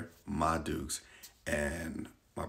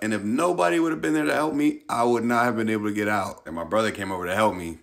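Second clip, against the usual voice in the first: ordinary talk, nothing marked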